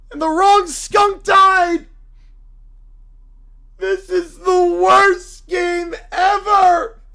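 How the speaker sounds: loud, anguished preacher's cry; a wounded zealot shouting at the sky; outraged preacher. Acted. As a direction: howl it like your church just fell. Character lament.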